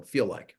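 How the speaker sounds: "feel" leaned on, then "like" falls away; stress on feel. Shown as a demonstration. Advisor telling parents to ask how money feels, stress on "feel". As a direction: punch the word "feel". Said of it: "emphasis on the word feel".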